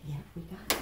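soft whisper. Real, not acted. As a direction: breathe it softly to calm her. Calming pet during exam.

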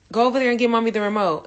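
quick, loud request rattled off; talking fast. Retold or imitated. Mother telling how she asks her daughter for the remote, fast. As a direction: rattle it off too fast for a small child. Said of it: "sometimes I'm like".

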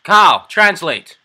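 loud call. Real, not acted. Commanding friend loudly.

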